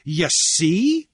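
high, loud declaiming voice; Cornelius, triumphant orator. A narrator's reading. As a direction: declaim like a confident debater.

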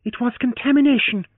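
a flash of indignant scorn; flashing retort. Acted. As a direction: fling the words at him. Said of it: "she flashed for his benefit".

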